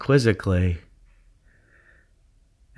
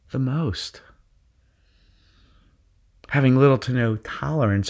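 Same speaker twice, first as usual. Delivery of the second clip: guided meditation. Acted. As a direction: ease them into their zone. Leads a guided visualization.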